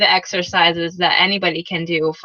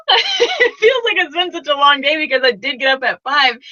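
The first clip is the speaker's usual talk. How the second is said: higher voice, real laughter through the words; laughing; 6 semitones higher than usual. Real, not acted. Coach laughing about a long day since five, laughing. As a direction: talk through a giggle at yourself.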